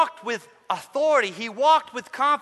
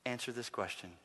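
ordinary talk

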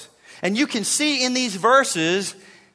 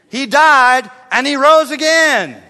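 slow, louder and higher declaration; preaching proclamation; 5 semitones higher, 9 dB louder than usual. Acted. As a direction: proclaim it like a victory cry. Preaching climax on resurrection.

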